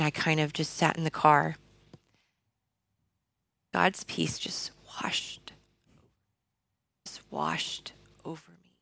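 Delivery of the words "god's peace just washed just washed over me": slow hushed whisper, barely voiced; awed whisper. Real, not acted. Recalling God's peace washing over her, in a slow whisper. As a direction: breathe it out softly, in awe.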